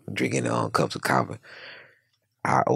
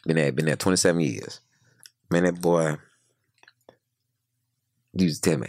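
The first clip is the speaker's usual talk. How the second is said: usual speaking voice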